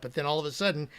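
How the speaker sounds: ordinary delivery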